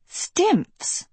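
high, startled one-word query; puzzled surprise. A narrator's reading. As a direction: echo it baffled, pitch leaping. Sophie asks about unknown word.

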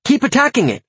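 high, urgent yell; yelled order. A narrator's reading. Master ordering the imp on mid-fight, yelling. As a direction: pitch the command way up. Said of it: "I yelled".

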